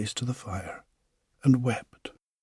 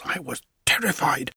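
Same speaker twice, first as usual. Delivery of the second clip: ordinary delivery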